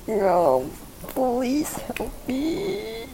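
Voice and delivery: high, slow pained plea; pained pleading. Real, not acted. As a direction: beg through the pain. Massage hurting, pleading.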